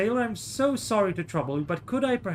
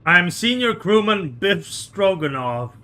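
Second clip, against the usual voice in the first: put-on louder, slow Russian-accented boast with stress on "senior"; slow, loud mock Russian introduction; bolder Russian sailor; 6 dB louder, slower than usual. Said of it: "I'm just gonna take a moment".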